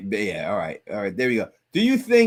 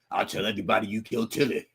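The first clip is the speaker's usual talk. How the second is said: low, quick mock threat; imitating Boyd's threat; 4 semitones lower, 4 dB quieter, faster than usual. Co-host quotes Boyd threatening Elgin, mock menace. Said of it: "he said it like that".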